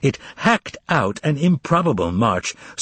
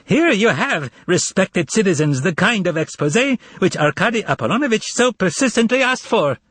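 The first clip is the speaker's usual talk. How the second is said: higher, mocking proclamation to the hall; crying out; 8 semitones higher than usual. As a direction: announce it high like a gleeful showman. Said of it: "cried out".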